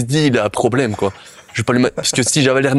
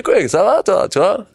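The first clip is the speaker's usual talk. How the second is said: high-pitched Belgian oaf greeting; exaggerated Belgian, pitched up; playful Belgian character; 9 semitones higher, slower than usual. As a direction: hail a mate as the silly Belgian. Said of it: "Et je suis le Belge".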